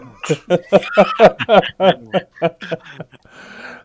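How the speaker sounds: big real laugh; loud laugh. Real, not acted. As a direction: burst out laughing. Table cracks up.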